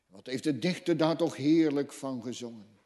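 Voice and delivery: ordinary talk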